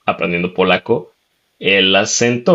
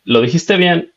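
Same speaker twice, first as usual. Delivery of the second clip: tono alto y ligero de novia, casi divertida; imitando a la novia; 9 semitones higher than usual. Retold or imitated. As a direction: admite que la palabra era correcta, en tono agudo. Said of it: "y ella me decía". (la novia le dice que lo dijo bien, con voz aguda)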